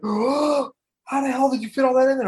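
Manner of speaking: high, slow mock astonishment; hammed-up disbelief, drawn out; imitated amazement. Imitating amazed visitor seeing office.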